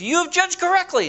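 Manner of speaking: high, bright emphatic approval; emphatic praise. Retold or imitated. Jesus praising Simon, high and emphatic. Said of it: "it's real emphatic".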